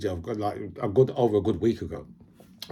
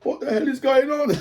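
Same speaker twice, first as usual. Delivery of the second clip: high, loud startled yell; alarmed shout; 13 semitones higher, 3 dB louder than usual. Retold or imitated. Sergeant at arms reacting to the scuffle, high startled shout. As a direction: sergeant at arms bursts out in shock. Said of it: "the sergeant at arms was like".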